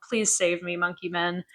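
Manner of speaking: soft, timid plea for help. Retold or imitated. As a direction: murmur it like a helpless victim.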